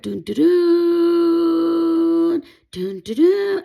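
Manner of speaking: drawn-out high loud trumpet blasts; imitated trumpets. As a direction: sing out the trumpet call slowly, heralding. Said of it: "I heard the trumpets, like, extremely loud".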